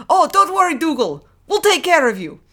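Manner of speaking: loud, high-pitched, badly faked Irish priest; botched Irish accent. Father Ted reassuring Dougal, in a bad Irish accent. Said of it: "Which accent was that again?".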